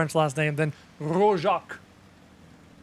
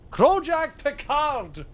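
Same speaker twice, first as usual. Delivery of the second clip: grand announcement; 9 semitones higher, much slower than usual. Acted. Trying out a name grandly.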